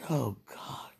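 breathy whispered plea. Real, not acted. Praying alone, whispers to God. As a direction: barely breathe it out.

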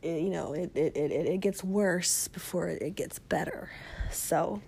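whispery voice barely there; hoarse voice fading to a whisper; voice giving out. Real, not acted. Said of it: "I'm losing my voice".

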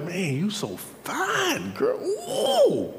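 drawn-out swoon soaring into a very high ooh; hammed-up admiration. Husband admires his doubting wife, hammed-up swooning. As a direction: stretch the praise, then whoop high.

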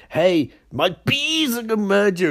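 high-pitched villain threat; mild mock scream. Bee woman threatening a victim, mildly screamed mock threat. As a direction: imitate a scream without much volume. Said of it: "she screams down the microphone".

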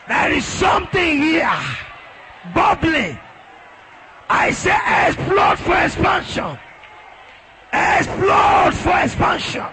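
high, loud, urgent prayer shouts; full-force prayer preaching. Real, not acted. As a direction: drive the congregation into fervent prayer at full voice.

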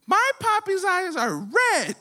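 put-on high, slow child voice; little girl voice. Comic telling his daughter's words in class, high slow child voice. Kid quoted in show and tell.

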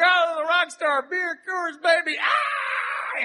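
high, wild rock-star rant with a shout; squealing rock-star parody; mock rock star. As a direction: ham up a wasted rock star wailing. Mocking his own rock-star rant.

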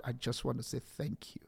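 hushed, heartfelt whisper to God; whispered thanks. Real, not acted. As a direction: let the prayer drop to a whisper. Emotional prayer of thanks to God.

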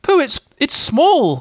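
high, loud, stammering squeak; tiny creature's loud exclamation; excited cry. A narrator's reading. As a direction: cry out slowly in high-pitched amazement. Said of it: "cried Piglet".

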